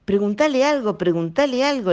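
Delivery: high, pressing whisper-urge to her husband; urgent wife's nudge. A narrator's reading. Wife tugging husband's sleeve, urging him to ask. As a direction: nag him quickly, insistent. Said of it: "le dijo la Candelaria tirándole de la manga".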